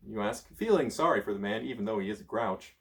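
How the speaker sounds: ordinary delivery, nothing marked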